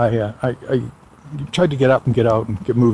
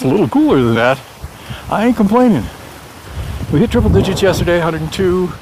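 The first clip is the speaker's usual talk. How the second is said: fast, upbeat radio announcer; 5 semitones higher, faster than usual. Real, not acted. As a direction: read the weather like a DJ. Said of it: "I sound like a radio D J".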